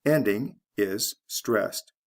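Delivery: ordinary talk, nothing marked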